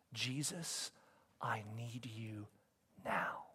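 slow, low, near-whispered plea; quiet, earnest appeal to Jesus; hushed prayer. Real, not acted. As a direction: pray softly, word by word. Prays aloud in the sermon.

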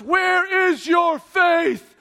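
loud, drawn-out, high proclamation; overblown, stately shout; booming mock rebuke. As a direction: thunder it slowly like a grand rebuke. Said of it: "That's not how this plays out".

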